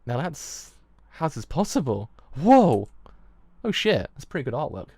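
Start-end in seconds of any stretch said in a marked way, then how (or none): 2.3-4.1 sudden loud surprised whoa; startled whoa. Real, not acted. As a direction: jolt back and blurt it out loud. Startled by a game image.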